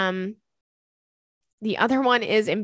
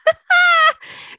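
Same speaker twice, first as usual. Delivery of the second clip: loud high laugh; 9 dB louder than usual. Real, not acted. Cracking up at own story.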